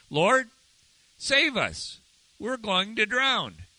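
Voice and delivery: high-pitched, pleading cry; acted plea for rescue. Disciples waking Jesus in the storm, acted urgent plea.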